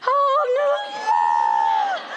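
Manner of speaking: very high, long drawn-out "oh no"; mock-panicked cry, stretched right out; horrified retold wail. Mother hearing her child blurt out gossip, high comic horror.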